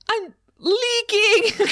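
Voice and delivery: very high, slow mock-wailing Grinch; crying Grinch. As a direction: ham up the Grinch's tearful squeal. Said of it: "'cause he starts crying".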